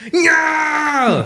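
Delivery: high shouted Arnold yell; German accent. Pretend. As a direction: bark it like Schwarzenegger. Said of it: "pretty good impression of a German".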